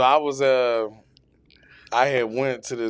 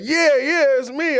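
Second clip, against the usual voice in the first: lightly voiced [name]; 13 semitones higher, 4 dB louder than usual. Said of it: "He was like".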